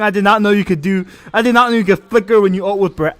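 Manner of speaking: loud, excited caster calls; hyped play-by-play. Acted. Streamer casting his friends' match, excited play-by-play. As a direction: call every play like a shoutcaster.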